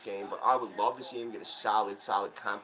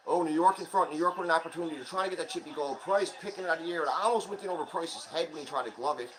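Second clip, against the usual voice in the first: live play-by-play; 5 semitones higher than usual. Real, not acted. Fan narrating the hockey game live for viewers, excited play-by-play. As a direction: call every rush as it happens.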